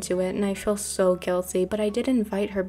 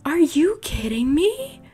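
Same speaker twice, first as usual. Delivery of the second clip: high, loud, drawn-out mock outrage; hurt outrage; 4 semitones higher, 4 dB louder, slower than usual. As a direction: wail it like a betrayed lover, hammed up. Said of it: "I'm hurt".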